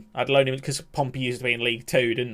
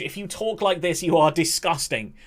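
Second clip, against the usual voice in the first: usual speaking voice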